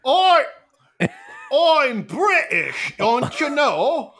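loud, high, drawn-out mock British; badly faked British accent, every word stretched; bad British impersonation. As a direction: overblown British toff parody. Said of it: "talking like a British person impersonations".

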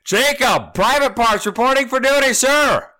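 high, loud recruit shout; stiff barking toy soldier. Acted. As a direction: scream it like a cadet. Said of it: "Thank you for busting out the levels".

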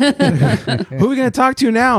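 high, drawn-out mock drunk voice; slow it down into a tipsy high whine; imitating drunk wife. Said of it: "She's shittered at this point".

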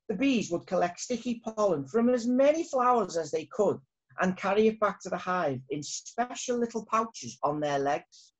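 usual speaking voice

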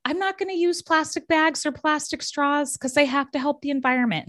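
high, self-righteous imitation of people; mock earnest activist. Mocking people who swear off plastic straws, high and preachy. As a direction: send up the well-meaning do-gooder. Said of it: "people being like".

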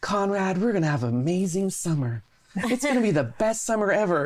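high, bright, overeager promise of summer; chirpy hospital friend. Retold or imitated. Old friend from the hospital tells Conrad, forced and high. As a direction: sell a too-happy forced cheer. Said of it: "and she's like".

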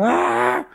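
high wailed "aah"; reenacted fright, a long high cry; startled cry. Retelling a scare in the shower, high wail.

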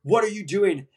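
high, furious berating shout; imitating an enraged scrub tech; angry yell. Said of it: "and he's like".

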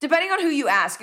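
normal talking voice

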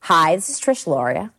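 put-on a light Delco accent, like a local dialect sample; a lightly voiced Delco accent greeting from a recording; Trish Loria Delco accent. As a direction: introduce yourself in an easy Delaware County Delco accent. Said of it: "one woman named Trish Loria".

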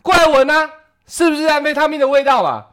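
very high, loud shout down the hall; loud challenging call to all the neighbors; calling out loudly. Retold or imitated. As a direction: yell to the whole floor. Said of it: "对着整个那个楼层的喊说".

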